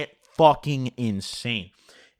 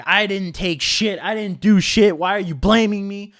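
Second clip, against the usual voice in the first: retold yell, a bit higher and louder; mock yell; 5 semitones higher, 5 dB louder than usual. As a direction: imitate her yelling accusations, half-hearted. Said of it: "starts fucking yelling".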